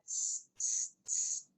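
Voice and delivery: soft whispered tss tss; monkeys tsk-tsking. Acted. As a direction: tut back at him like a monkey. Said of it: "the monkeys ... said".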